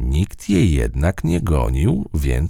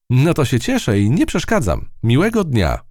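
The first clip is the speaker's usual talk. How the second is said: mouse admiring; 11 semitones higher than usual. A narrator's reading. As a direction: marvel at the web. Matylda hurries off.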